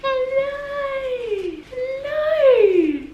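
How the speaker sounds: high sing-song dog voice. Real, not acted. Calling the puppy on camera.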